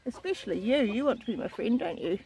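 ordinary delivery

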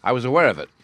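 understated knowing retort; dry. A narrator's reading. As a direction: say it as a dry acknowledgement. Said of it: "said Holmes dryly".

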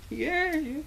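soft, high mock cheer; faraway fan's cheer; small wave cheer. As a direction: squeal a little pretend "yeah" softly. Wave passes on to others.

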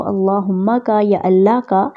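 normal talking voice

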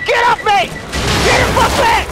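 very high, panicked shout; frantic fighting yell. Real, not acted. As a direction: yell to fend off an attacker. Fighting off the boss.

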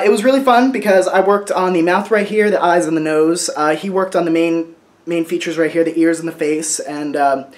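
ordinary talk

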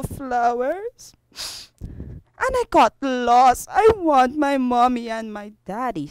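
acted sobbing explanation, fading down; tearful little boy. Lost boy explaining to the officer, tearful.

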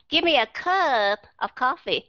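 "cup" drawn out into "cub"; long vowel in cup. Shown as a demonstration. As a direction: stretch "cup" long mid-sentence. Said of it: "nghe cái sự kéo dài".

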